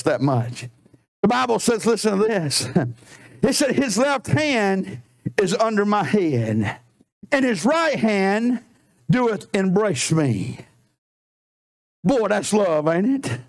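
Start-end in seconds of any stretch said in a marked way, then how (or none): 3.8-10.6 drawn-out, reverent verse read slowly; slow scripture reading. Acted. Preacher slowly reading Song of Songs to the church.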